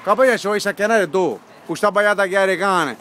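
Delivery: ordinary talk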